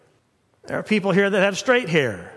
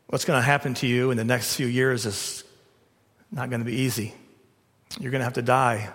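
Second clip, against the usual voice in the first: lower, soft and close; tender Jesus; 5 semitones lower, 4 dB quieter than usual. Retold or imitated. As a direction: gently confide to Peter. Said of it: "had his arm around him and said".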